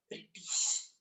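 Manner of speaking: breathy stage whisper, barely audible; conspiratorial whisper to the class; whispered answer. Acted. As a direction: drop to a secret whisper for the punchline.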